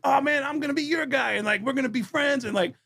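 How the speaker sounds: high hammed-up bro gushing; high mock bro voice. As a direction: play the overeager new friend. Mocking eager bro talk.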